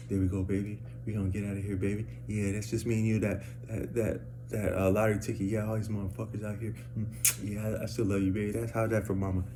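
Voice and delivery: hushed imitation of her private sweet talk; woman coaxing lottery ticket. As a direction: murmur softly to the ticket as her.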